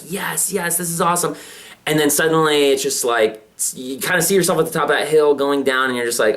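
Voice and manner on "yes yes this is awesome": mock excitement.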